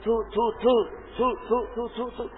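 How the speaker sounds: a frightened brother trying to say Yusuf; fearful stammer. Shown as a demonstration. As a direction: stammer out of fear. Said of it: "ڈر میں آتا ہے نا تو اٹکنا".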